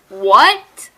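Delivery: loud, rising cry of disbelief; hammed-up loud shock; shocked outburst. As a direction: blurt it out, stunned. Shocked reaction to story twist.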